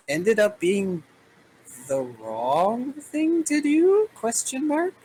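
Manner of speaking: rising, drawn-out doubt; slow questioning rise up high; uncertain. Real, not acted. GM unsure, voices a question.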